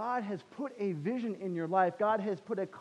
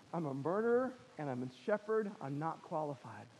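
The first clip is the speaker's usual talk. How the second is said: dejected self-doubt; 4 semitones lower, 10 dB quieter than usual. Retold or imitated. Voicing the doubting voice Moses hears.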